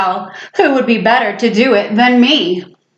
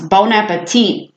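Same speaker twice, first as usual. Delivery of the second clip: ordinary delivery, nothing marked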